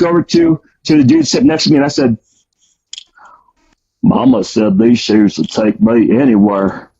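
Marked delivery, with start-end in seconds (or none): 4.0-6.9 slow, lower Southern movie-quote drawl; slow, lower Forrest Gump line quoted to the inmate beside him; Forrest Gump impression. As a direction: drawl it out slowly like the movie hero. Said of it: "I love that movie, Forrest Gump".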